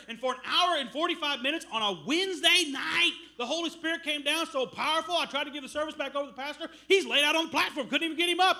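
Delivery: loud, swelling preacher's climax; preaching at full force. Acted. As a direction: build it up to a shout of praise. Preaching climax about the service.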